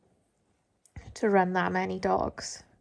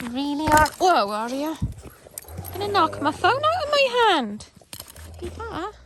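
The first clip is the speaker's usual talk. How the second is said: pet talk voice; 9 semitones higher, 6 dB louder than usual. Real, not acted. Baby talk to dog.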